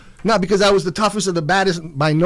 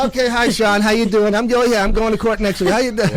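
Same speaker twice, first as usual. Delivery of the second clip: high, louder acted chat; phone greeting; 6 semitones higher, 4 dB louder than usual. Mother and son on the jail phone, high-pitched greeting. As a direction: play both ends of a quick family hello.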